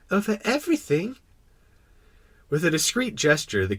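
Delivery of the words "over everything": put-on high questioning child voice; astonished little prince. Little prince echoing the king's claim, astonished. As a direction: echo it as an amazed question.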